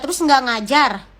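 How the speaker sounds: ordinary delivery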